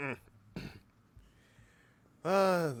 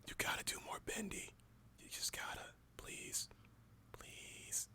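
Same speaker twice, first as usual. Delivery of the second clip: pleading whisper, no voice at all; whispered plea; 6 semitones higher, 16 dB quieter than usual. Pretend. Co-host begging Rogue for more Bendy, whispered plea.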